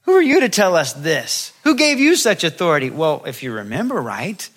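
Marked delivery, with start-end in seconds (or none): 0.0-2.9 loud, higher challenge; acting out the grumbling Israelites; mock complaining. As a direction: challenge his authority indignantly. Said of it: "they started complaining against Moses".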